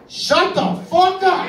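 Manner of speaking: high, slow disbelieving shout; shocked yell. Retold or imitated. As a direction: act stunned by the dish. Surprised at strange Tupperware.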